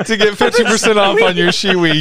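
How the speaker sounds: high, giggly through laughter; laughing. Real, not acted. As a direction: laugh while reading the promo. Repeats ad line while laughing.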